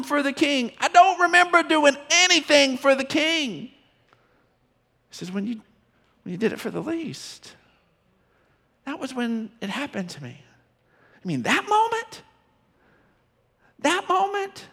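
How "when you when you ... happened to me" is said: much softer, quiet, tender answer; gentle reply. Retold or imitated. The king answering his people, soft and tender. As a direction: let it land softly like a kind king.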